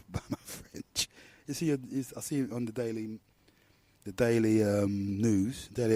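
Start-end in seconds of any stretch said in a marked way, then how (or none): none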